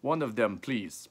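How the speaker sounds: put-on polite request in a Russian accent; Russian-accented buyer. A narrator's reading. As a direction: ask with a light Russian accent. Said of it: "someone with a Russian accent".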